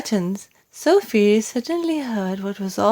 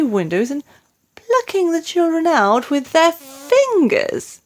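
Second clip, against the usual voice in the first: distressed queen; 8 semitones higher than usual. A narrator's reading. Queen describing vivid nightmare.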